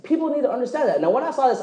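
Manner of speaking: usual speaking voice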